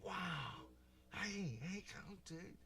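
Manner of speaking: high-pitched awed hail to a bear; amazed greeting. Retold or imitated. Retells hailing a bear while tripping.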